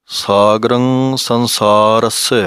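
ਸੰਸਾਰਸ said slowly, ending on a plain ਸ; slow ਸਾਗਰੰ ਸੰਸਾਰਸ with a clean final ਸ; careful recitation demo; correct pronunciation. Shown as a demonstration. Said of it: "ਸੰਸਾਰਸਯ ਪਾਠ ਨਹੀਂ ਬੋਲਣਾ".

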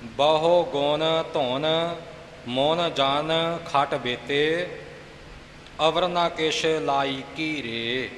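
slow chant with clear breaks after ਬਹੁ ਗੁਣ ਧੁਨ; slow recitation, pausing at each break; deliberate chant that marks every pause; mind the pauses. Shown as a demonstration. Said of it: "ਵਿਸ਼ਰਾਮ ਦਾ ਤੇ ਜਮਕ ਦਾ ਖਿਆਲ ਰੱਖਣਾ".

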